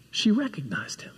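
normal talking voice

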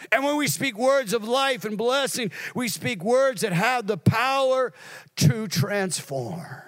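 high, driving sermon voice; impassioned preaching. Real, not acted. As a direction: preach it out, slowing on the last words. Preaching at full force.

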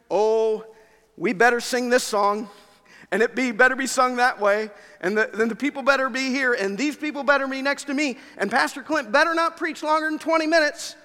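loud, high, bossy list of demands; entitled demands. Acted. Preacher mocks an entitled worshipper's demands about the service. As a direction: act the picky churchgoer laying down rules.

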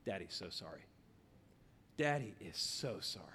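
very soft, low, breaking toward a whisper; tender father apologizing to his child; choked up. Retold or imitated. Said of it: "And, man, I choke".

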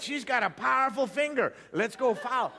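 high, eager and giddy; excited. Retold or imitated. Own retold excited quote.

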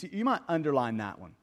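ordinary delivery

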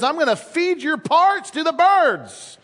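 high, hammed-up giant threat; giant's threat. Preacher quoting the giant to prove the Bible exciting, high mock threat. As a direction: shout it out in mock menace. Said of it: "some giant says".

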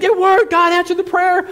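high, loud acted jubilation; super excited. Healed woman jumping around before minister, super excited cry. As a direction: shout the good news up high. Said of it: "She's super excited".

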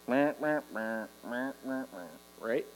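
low, droning bored meh; mock the bored churchgoer's drone; mundane drawl. Shown as a demonstration. Preacher mocks bored churchgoers, lazy droning drawl. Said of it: "like, mundane drawl".

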